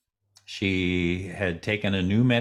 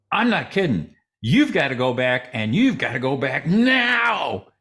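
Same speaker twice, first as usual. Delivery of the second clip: louder, higher, insistent warning; forceful insistence; 6 semitones higher, 4 dB louder than usual. Retold or imitated. Mary Fran ordering the sister back, forceful. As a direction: insist hard, wagging a finger. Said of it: "she said it with such force".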